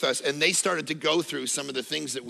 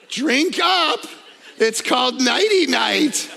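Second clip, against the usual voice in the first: louder, higher, slow and laughing; hammed-up replay; 6 semitones higher, 5 dB louder, slower than usual. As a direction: ham up the creepy tea offer. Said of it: "That's actually what happened".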